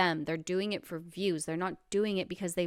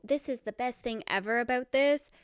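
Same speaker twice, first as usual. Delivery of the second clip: higher-pitched gushy imitation; put-on excitement, pitched up; mock gushing positivity; 6 semitones higher than usual. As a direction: imitate someone being over-the-top positive. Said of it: "they're so positive and so".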